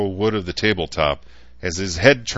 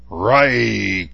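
skeptical growl; 4 semitones higher, 6 dB louder than usual. A narrator's reading. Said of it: "Cantor growled skeptically".

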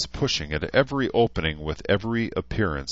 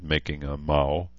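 plain reading voice

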